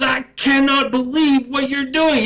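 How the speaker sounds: high, loud barking sergeant; drill sergeant yell. Retold or imitated. Drill sergeant yelling at a recruit who looked down. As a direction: bark it like a drill instructor.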